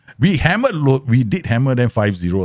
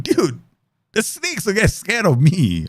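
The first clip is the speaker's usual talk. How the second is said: cocky retort; 8 semitones higher, slower than usual. Retold or imitated. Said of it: "I'm like".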